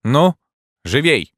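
loud, high royal command; booming warrior king. A narrator's reading. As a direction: bellow the order to hurry. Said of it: "воскликнул король".

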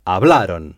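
hablaron with the stress landing on -ron; teacher's model of hablaron; model pronunciation. Shown as a demonstration. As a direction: repeat hablaron, leaning on the ending. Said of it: "C'est ça".

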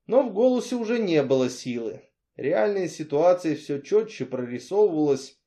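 plain reading voice

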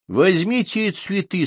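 high, slowed order; command. A narrator's reading. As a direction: give a curt order. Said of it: "снова приказал он".

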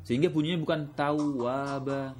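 normal talking voice

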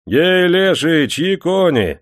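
loud, slow, high call from outside; rough yard shout. A narrator's reading. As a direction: bellow it across the yard. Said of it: "закричали вдруг со двора".

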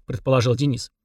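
plain reading voice

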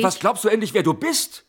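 loud, high, furious yelling; angry shout. Acted. Husband yelling at his wife in a furious shout. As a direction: shout the challenge at her.

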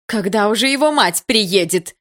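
loud, high, irritated question; nervous impatience. A narrator's reading. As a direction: demand it edgily, out of patience. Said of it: "нервно спросила Екатерина Михайловна".

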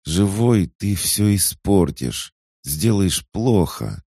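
high, slow, tearful begging; weeping sister begs him not to come; sobbing plea. Acted. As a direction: cry out the words through sobs. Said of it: "Она судорожно всхлипывала".

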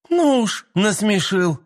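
high scornful snort of a reply; scoffing. A narrator's reading. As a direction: scoff at the very idea. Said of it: "фыркнула тётя Марина".

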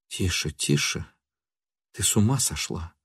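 very soft, alarmed reproof; hushing. A narrator's reading. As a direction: hush her under your breath. He tries to hush her.

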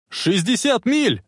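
high, drawn-out warning shout; shouting into radio. A narrator's reading. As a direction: shout the speed slowly and high. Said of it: "закричал в рацию Марти". (протяжный крик в рацию)

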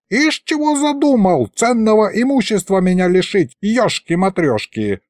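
put-on high-pitched old man's outrage; indignant. A narrator's reading. Sorcerer rails at losing valuable property, indignant. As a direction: rail at the thief's cheek.

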